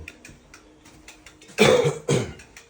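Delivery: loud dry cough; allergy cough. Real, not acted. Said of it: "Excuse my allergies".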